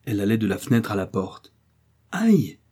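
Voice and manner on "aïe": high startled yelp; a quick surprised ouch; stepping into a puddle, sudden high cry. A narrator's reading. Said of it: "s'écria-t-elle tout d'un coup".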